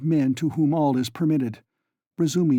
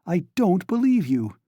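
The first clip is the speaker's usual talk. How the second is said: higher, louder distraught cry; louder, higher repeat of the denial; 7 semitones higher, 3 dB louder than usual. A narrator's reading. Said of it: "cried Donya".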